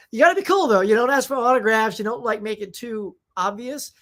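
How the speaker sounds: usual speaking voice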